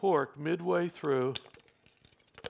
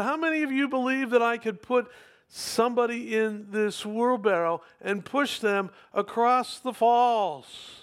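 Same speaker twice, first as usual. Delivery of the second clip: raised, higher showman's challenge; calling out; 5 semitones higher, 3 dB louder than usual. Acted. Tightrope walker daring the crowd, calling out. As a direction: project it out to a whole crowd.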